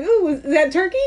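mock curious wife; teasing question. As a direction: tease him about the turkey. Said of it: "I'm like".

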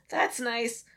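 ordinary talk, nothing marked

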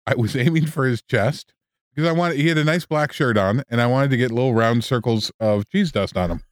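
normal talking voice

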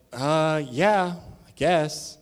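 high, slow and unsure, a put-on shrug; hesitant shrug. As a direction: drag it out like you barely care. Unsure reply to job offer.

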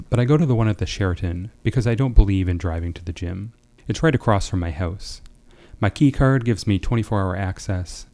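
steady first-person story reading. Acted. Writer reading his story aloud to the class, steady narration. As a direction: read your flash fiction to the group, evenly paced. Reads own short story.

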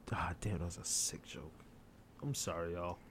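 hushed whisper after a dirty joke; whispered aside. Real, not acted. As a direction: drop to a whisper for the punchline. Whispered punchline after a dark joke.

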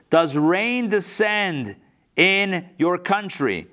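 very slow, deliberate question; slow pointed question. Acted. King pressing Alexander, slow deliberate question. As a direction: draw out the whole question. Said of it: "Listen to this".

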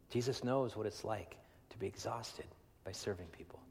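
hushed whisper, almost no voice. Real, not acted. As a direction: lean in and whisper it. Quiet aside on exhaustion.

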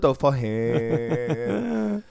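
slow, high, loud mock grumble; mock grumbling coworker. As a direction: drag it out like a sulky rant. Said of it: "the theme song in her fucking head".

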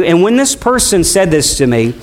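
normal talking voice

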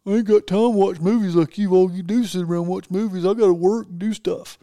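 put-on grumbling voice, teasingly exaggerated; mocking friends' excuse. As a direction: imitate buddies griping that they're too busy. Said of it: "they're always saying the reason".

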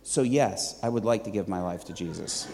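imitating a flat voice, low and soft; low, subdued delivery drained of enthusiasm; emotionless. Waitress confessing what she told her boyfriend, emotionless. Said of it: "no enthusiasm, no emotion whatsoever".

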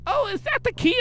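put-on high, soft old-lady voice. Old lady asks about the washroom key, soft high voice. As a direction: pitch it way up like a little old granny. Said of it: "There's this old lady, and she's like".

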